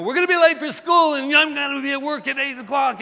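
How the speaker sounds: high, loud hurried yell; panicked mother rushing the kids out; frantic mom. Retold or imitated. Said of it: "I had her go".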